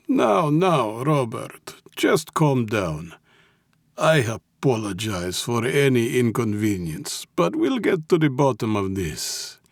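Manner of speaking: put-on calm him down in a Russian accent; soothing Russian mob boss. A narrator's reading. Mob boss apologizes to his captive, soothing.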